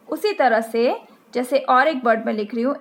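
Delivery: normal talking voice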